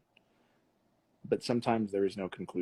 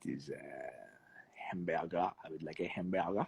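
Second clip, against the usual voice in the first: lightly faked French accent; 3 semitones lower, 7 dB quieter than usual. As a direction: order a hamburger in mock French. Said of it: "his French accent is quite epic".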